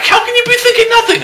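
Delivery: very high-pitched, exasperated mock woman; squeaky put-on voice of disbelief; incredulous questioner. Woman questioning a man about his empty mind, incredulous.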